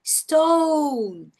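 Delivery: "stone" drawn out, o curving into u, closing on n; long O curve. Shown as a demonstration. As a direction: stretch "stone" high, then glide down. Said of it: "você faz essa curva".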